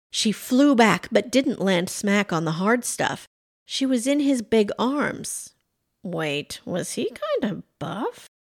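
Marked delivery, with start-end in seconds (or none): 6.0-8.3 hushed, pitch lifting into a question; intrigued aside. A narrator's reading. Pam caught in his big arms, hushed intrigued aside. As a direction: softly wonder to yourself. Pam's private surprised thought.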